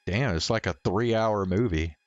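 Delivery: ordinary delivery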